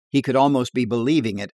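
ordinary delivery, nothing marked

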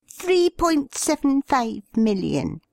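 high, reedy insistent repeat. A narrator's reading. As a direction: correct her, reedy and high. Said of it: "repeated the bearded man".

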